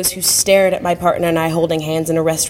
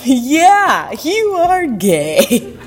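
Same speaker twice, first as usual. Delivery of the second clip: slow, higher, breaking into a laugh; starting to laugh; 6 semitones higher, much slower than usual. Retold or imitated. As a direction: admit it with a grin, laughing. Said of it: "starting to laugh and saying out loud".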